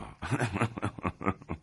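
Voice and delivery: a quiet, amused laugh at the best man; quiet mocking chuckle; soft laugh. A narrator's reading. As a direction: chuckle under the breath. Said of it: "این که خنده نداشت".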